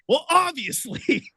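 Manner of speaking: dry retort. Retold or imitated. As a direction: state the obvious, slowly and dry. Jason's dry reply.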